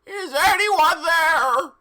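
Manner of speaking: high, loud, slow yell; a shrill-free stretched high cry of alarm. Acted. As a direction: call out into the dark, high and loud. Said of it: "yelled Mister Norris".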